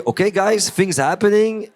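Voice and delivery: rally the guys; excited call to friends. Retold or imitated. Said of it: "But to say".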